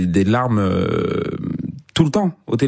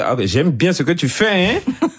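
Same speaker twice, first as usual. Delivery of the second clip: higher, emphatic Ivorian accent; weighty, melodic Ivorian French; 7 semitones higher than usual. Shown as a demonstration. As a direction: lean into the Ivorian accent with more relief. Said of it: "il y a plus de relief dans cet accent-là".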